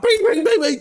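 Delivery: high, loud repeated clanging noises; imitated pan banging. Shown as a demonstration. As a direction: bang pans with your voice, high and urgent. Imitates banging pans at bear.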